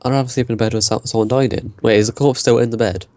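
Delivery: ordinary talk, nothing marked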